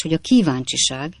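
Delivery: plain reading voice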